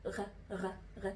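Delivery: "غ" between Arabic ghain and kha; soft, low uvular French R on each "غ"; French R sound. Shown as a demonstration. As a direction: demonstrate "غ" quietly as a French "re". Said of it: "مش بتنطق غين ولا خ".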